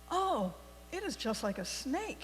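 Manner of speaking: ordinary talk, nothing marked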